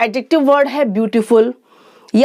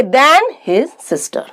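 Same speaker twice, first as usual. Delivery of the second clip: slow example; much slower than usual. Shown as a demonstration. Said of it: "than लगाना है".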